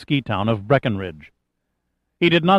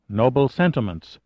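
ordinary delivery

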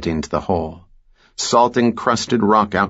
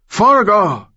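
desperate high scream; 12 semitones higher, 4 dB louder than usual. A narrator's reading. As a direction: scream the name in rage. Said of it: "Rivera screamed".

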